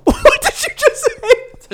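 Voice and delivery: high, loud, slow laughing question; helpless laughing disbelief; cracking up. Real, not acted. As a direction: squeal it through real laughter. Cracking up at odd sign-off.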